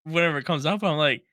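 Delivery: usual speaking voice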